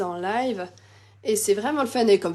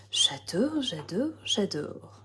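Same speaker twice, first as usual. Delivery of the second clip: hushed, low repeats, sinking softer each time; soft savouring; 5 semitones lower, 8 dB quieter, slower than usual. Real, not acted. Crafter admiring her card to herself, softly savouring. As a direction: murmur it over and over, fading.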